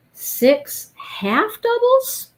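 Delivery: drawn-out high. Real, not acted. Reading pattern step.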